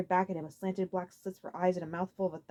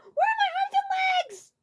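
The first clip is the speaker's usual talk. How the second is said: very high, slow, loud scream; horrified wail; panicked scream; 6 dB louder, slower than usual. A narrator's reading. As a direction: shriek in terror. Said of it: "let out a scream".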